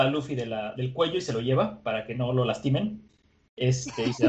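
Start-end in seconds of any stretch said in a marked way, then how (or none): none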